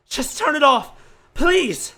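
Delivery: loud, high-pitched cry; desperate plea. Acted. Judge pleading to stop the recording, desperate shout. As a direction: beg at full voice for it to stop.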